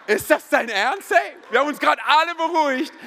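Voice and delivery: high, loud exasperated protest; hammed-up outrage at a friend; indignant disbelief. Indignant reaction to friend's taunt.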